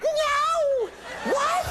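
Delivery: very high mock shriek; hammed-up motherly outrage in falsetto; imitated shocked mom. Comedian mimics mom shocked by the gossip, shrieking falsetto. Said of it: "and my mom was like".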